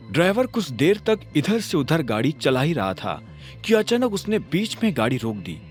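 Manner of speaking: ordinary delivery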